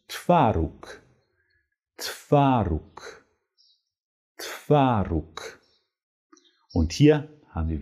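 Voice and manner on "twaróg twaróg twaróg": "Twaróg" as "tvaruk", clear and falling; Polish model word. Shown as a demonstration. Teacher modelling Polish word for cheese. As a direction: give "Twaróg" slowly and crisply. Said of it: "Der Quark heißt auf Polnisch".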